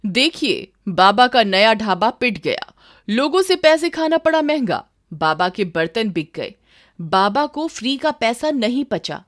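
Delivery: loud, breathless TV headline shouting; sensational news anchor. Retold or imitated. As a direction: hype it up like a channel screaming breaking news. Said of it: "ये सब एक नई खबर बन गई". (सनसनीखेज़ news anchor की ऊँची ज़ोरदार आवाज़)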